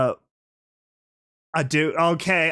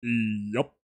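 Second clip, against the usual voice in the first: noticeably lower, rumbly low voice; a deep low voice that just came out today; Big Mac voice; 8 semitones lower, 4 dB quieter than usual. Real, not acted. Streamer joking to chat about his deep low voice. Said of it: "I got the Big Mac voice now".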